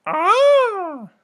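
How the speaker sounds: high piercing mock screech of a pterodactyl. Teacher jokingly screeches like a pterodactyl. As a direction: shriek like a flying dinosaur.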